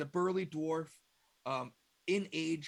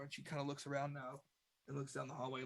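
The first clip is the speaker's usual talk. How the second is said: ordinary talk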